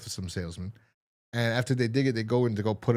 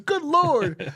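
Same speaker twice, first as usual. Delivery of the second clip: high, loud hammed-up old-timer; old man voice; 6 dB louder than usual. The old man reacting in a high, loud hammy voice. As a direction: squeak it high. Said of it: "He goes".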